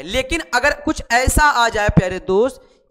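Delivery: ordinary talk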